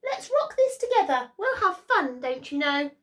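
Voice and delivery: high, cheerful little mouse; a friendly mouse cheering up the lion; high mouse voice, playful. Acted. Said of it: "Mouse peeped".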